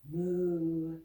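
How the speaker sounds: low cow moo. Pretend. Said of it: "And you can moo too".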